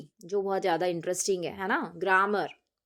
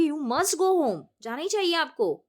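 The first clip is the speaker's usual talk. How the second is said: higher, emphatic insistence; forceful insistence; 6 semitones higher, 4 dB louder, slower than usual. Shown as a demonstration. Teacher modelling must for a seriously ill father, forcefully. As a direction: press it firmly, leaving no choice. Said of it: "हम उसको एकदम forcefully बोलेंगे".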